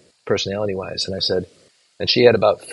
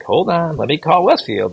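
higher-pitched imitation of a nurse; flustered nurse; 8 semitones higher, 4 dB louder than usual. Nurse to mom asking for her son, higher flustered voice. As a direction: act out a nurse putting someone on hold. Acting out nurse stalling the mother.

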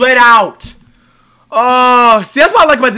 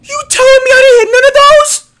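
disbelief; 13 semitones higher, 5 dB louder, slower than usual. Real, not acted. Disbelief at missed shots.

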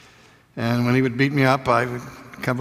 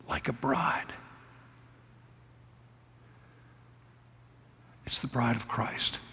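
hushed whisper; 11 dB quieter than usual. Acted. Preacher whispering to the congregation. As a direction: whisper it, barely voiced.

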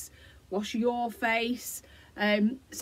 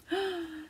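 1 excited gasp; 7 semitones higher, 7 dB quieter than usual. Shown as a demonstration. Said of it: "sit there and go".

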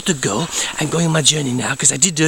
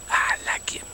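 secret little confession, whispered; whispered aside; 8 dB quieter than usual. Acted. The count confessing to viewers in a whisper. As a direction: breathe it like a secret. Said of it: "unvoiced whisper".

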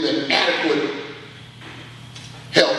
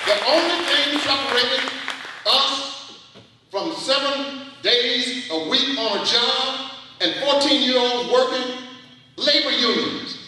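high, rousing rally preaching; impassioned union rally cry; fiery preaching; 9 semitones higher than usual. Acted. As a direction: lift the crowd about labor unions. Preaching at full force.

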